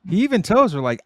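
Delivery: ordinary talk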